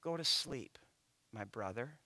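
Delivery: put-on softly, lulling a child to sleep; hushed, like a lullaby over a crib; soft bedtime murmur. Speaker to sleeping four-year-old, a soft hushed murmur. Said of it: "I just kinda said to him".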